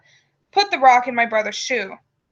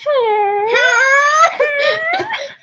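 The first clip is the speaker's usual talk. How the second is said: high cackle; 3 dB louder than usual. Acted. Sly giggle.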